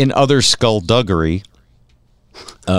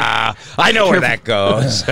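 mock Republican; 3 semitones higher than usual. Republican voiced quote.

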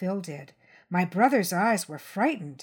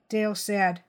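ordinary delivery

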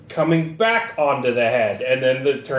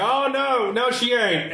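higher-pitched cry of protest; alarmed man; 7 semitones higher than usual. Acted. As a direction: voice pitched up in disbelief. Game master voices alarmed man.

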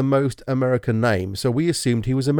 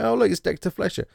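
high, pleased surprise at a familiar face; light up as you spot a known actor; delighted recognition; 9 semitones higher than usual. Retold or imitated. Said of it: "It's like".

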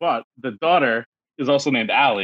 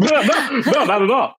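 laughing; 10 semitones higher, 3 dB louder, slower than usual. Real, not acted. Laughing denial of offence.

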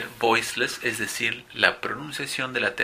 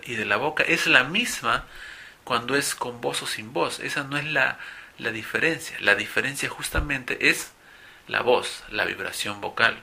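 usual speaking voice

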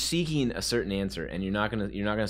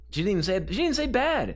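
pitched way up, insisting; high insistence; 12 semitones higher, 4 dB louder than usual. Real, not acted. Correcting a friend about what Gabby said, high insistent voice. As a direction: squeak out the correction.

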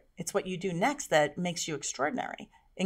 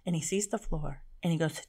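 ordinary talk

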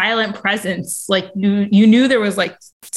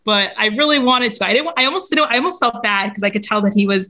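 ordinary talk, nothing marked

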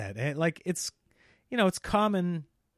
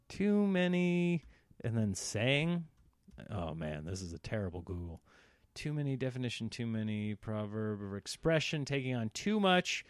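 ordinary talk, nothing marked

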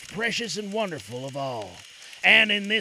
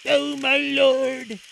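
put-on higher, slow courtly young hero; Aladdin voice; 7 semitones higher, 6 dB louder, much slower than usual. A narrator's reading. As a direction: address the king reverently.

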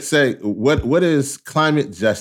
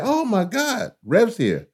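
excited greeting; 10 semitones higher, slower than usual. Pretend. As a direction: pretend high-pitched "oh my God". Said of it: "you say".

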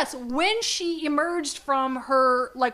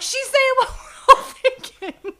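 high, loud, breaking into laughter; slow, squealing through laughter; laughing; 12 semitones higher, 11 dB louder, slower than usual. Real, not acted. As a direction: crack up while saying it. Cracking up at own joke.

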